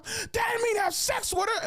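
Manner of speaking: screechy high shout; imitated freaking-out mom. Mom scolding son over the phone, frantic high-pitched shout. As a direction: mimic a mother shrieking her correction. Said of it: "Then my mom freaking out".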